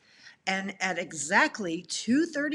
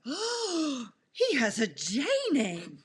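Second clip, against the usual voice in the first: amazed; 5 semitones higher, slower than usual. Retold or imitated. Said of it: "my eyes just bug like".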